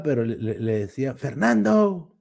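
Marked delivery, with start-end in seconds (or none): 1.1-2.1 long drawn-out hail, higher; mock call, very slow on the name; retold calling out. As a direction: imitate yelling after a friend in the street. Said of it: "un cuate que le gritaba".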